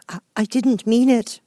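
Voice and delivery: high, slow, anxious plea; Alice pleading with the offended Mouse. Acted. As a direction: beg forgiveness earnestly. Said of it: "pleaded poor Alice".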